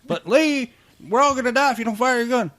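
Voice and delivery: high shouted urging under gunfire; frantic yelling. Acted. A soldier yelling at Lee to fire his gun, high frantic shout. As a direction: yell at Lee to shoot before they all die.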